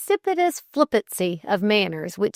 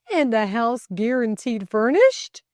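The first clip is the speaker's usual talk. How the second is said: loud, raised, indignant question; imperious roar; 5 semitones higher, 5 dB louder than usual. A narrator's reading. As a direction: protest it imperiously. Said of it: "he roared imperiously".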